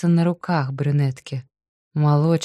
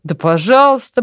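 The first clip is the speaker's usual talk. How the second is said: lordly; 7 semitones higher, 5 dB louder than usual. A narrator's reading. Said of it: "по-барски повёл рукой Толик".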